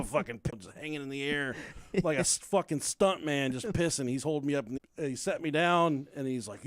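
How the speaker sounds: normal talking voice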